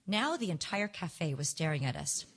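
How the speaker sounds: usual speaking voice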